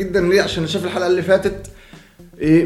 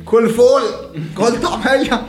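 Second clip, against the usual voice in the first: raised, higher-pitched call; mock street cry; 8 semitones higher, slower than usual. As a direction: call it out like a catchphrase. Quotes a known chant, asks "know it?.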